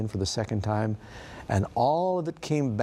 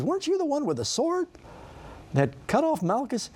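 much higher, pointed disbelief; incredulous accusation; 14 semitones higher than usual. Acted. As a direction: catch him out, voice shooting up. Servant accuses Peter of the sword.

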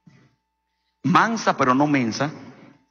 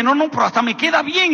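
excited; 9 semitones higher than usual. Retold or imitated. Excited about cheap jeans.

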